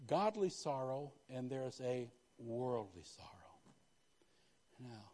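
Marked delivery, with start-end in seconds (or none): none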